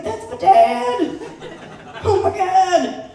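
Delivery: high, slow, laughing mock outcry; shocked outcry. Comic watching Cops and recognising her dad, laughing outcry. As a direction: cry out at the TV in mock horror.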